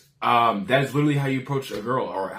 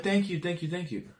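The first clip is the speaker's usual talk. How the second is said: put-on higher, fading girly thanks; girl voice; 6 semitones higher, 7 dB quieter than usual. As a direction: lightly play the flattered girl. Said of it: "she'll be like".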